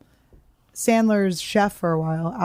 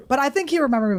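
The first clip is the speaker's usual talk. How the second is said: ordinary delivery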